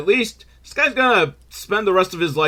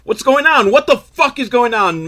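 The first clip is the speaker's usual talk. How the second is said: angry villain; 10 semitones higher, 5 dB louder than usual. Retold or imitated. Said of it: "and he's like".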